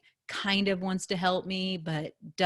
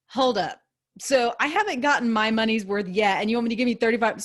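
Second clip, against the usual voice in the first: indignant confrontation; 3 semitones higher, 5 dB louder than usual. Retold or imitated. Acted customer confronting store clerk.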